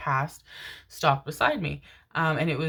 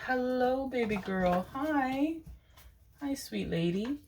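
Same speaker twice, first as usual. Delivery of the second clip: pet talk; 8 semitones higher, 5 dB quieter than usual. Real, not acted. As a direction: coo gently at the dog. Greeting her pet.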